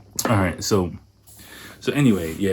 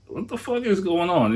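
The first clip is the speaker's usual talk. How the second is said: mock outburst of disbelief, much higher; incredulous outburst; 11 semitones higher than usual. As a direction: blurt it out in shock. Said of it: "And I'm just like".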